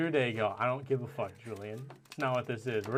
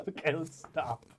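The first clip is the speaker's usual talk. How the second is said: ordinary talk, nothing marked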